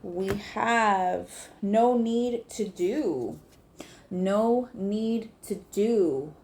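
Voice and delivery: drawn-out, deliberate reading; slow card reveal. Real, not acted. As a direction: savour each word slowly.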